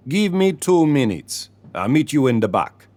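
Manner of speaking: selling the recipe in a Caribbean lilt; Caribbean accent. A narrator's reading. Leroy instructing Mrs Thompson in Caribbean accent. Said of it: "He spoke in a deep Caribbean accent".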